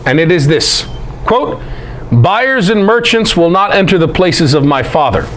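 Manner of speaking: ordinary delivery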